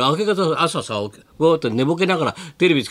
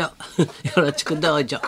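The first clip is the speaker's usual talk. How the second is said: laughing through Tsurutaro catchphrase; a hint of Tsurutaro, giggling; Tsurutaro impression. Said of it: "鶴太郎さんの真似".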